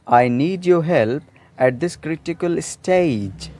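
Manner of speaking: slowly, each word spaced out; careful classroom reading; slow example sentence. Shown as a demonstration. Teacher reading the example sentence slowly for learners. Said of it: "मुझे इस मुश्किल वक्त में".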